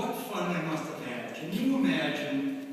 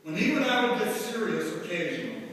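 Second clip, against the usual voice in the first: ordinary talk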